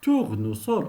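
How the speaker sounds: tournesol with S pronounced as s; correct pronunciation demo. Shown as a demonstration. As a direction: say tournesol as "tour-ne-sol", unvoiced s before -ol. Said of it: "le S se prononce S".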